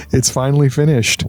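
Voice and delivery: ordinary delivery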